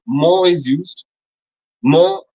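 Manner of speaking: "mo" with the n only nasalized; nasal French mon. Shown as a demonstration. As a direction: say mo with a nasal n, no full N sound. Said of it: "The N is actually a nasal sound".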